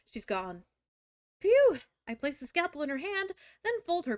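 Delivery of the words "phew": exaggerated relief. Real, not acted. Mock relief.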